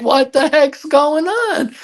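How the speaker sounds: high, slow what the heck; exasperated. Real, not acted. Complaining about her many partners, high and slow exasperation. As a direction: let the disbelief climb. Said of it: "exasperated rhetorical".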